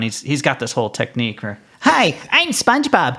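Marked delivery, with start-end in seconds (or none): 1.8-3.2 very high-pitched cartoon voice; high, drawn-out cartoon impression; SpongeBob. Shown as a demonstration. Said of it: "Let's hear your SpongeBob".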